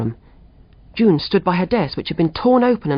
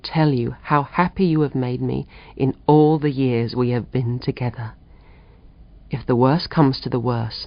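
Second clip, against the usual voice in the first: much lower, a husband's last loving letter; tender dying husband; 6 semitones lower than usual. A narrator's reading. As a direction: read it as Walter writing from the ward. Walter's letter read aloud.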